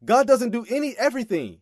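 ordinary talk, nothing marked

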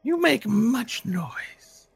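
high, slow, drawn-out questions; creepy voice from the dark; eerie creature voice. Acted.

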